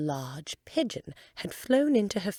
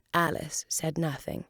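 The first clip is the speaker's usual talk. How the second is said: plain reading voice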